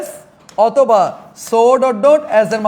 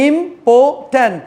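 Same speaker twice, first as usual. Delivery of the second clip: important very slow, with clear gaps: im, por, tant; drawn-out syllable count on important; broken into syllables; much slower than usual. Shown as a demonstration. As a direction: pull important apart into three isolated syllables. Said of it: "তিন চার syllable আছে".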